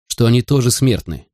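ordinary delivery, nothing marked

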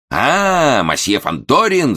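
loud bloodthirsty roar; shouting. A narrator's reading. Momus bellows at the valet, loud and menacing. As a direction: bellow it like a jealous husband. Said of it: "заорал Момус".

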